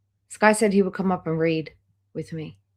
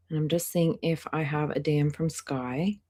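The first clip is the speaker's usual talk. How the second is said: usual speaking voice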